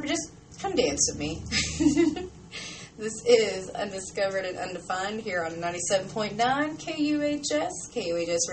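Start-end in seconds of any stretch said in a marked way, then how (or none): 1.5-2.9 high, giggly laugh; embarrassed giggle. Real, not acted. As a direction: laugh it off bashfully. Laughs at own flirt.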